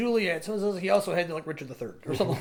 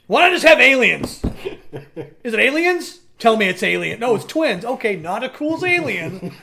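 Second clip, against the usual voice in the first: mock exasperation; 4 semitones higher, 9 dB louder than usual. Ranting at the book's plot.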